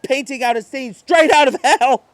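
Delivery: ordinary delivery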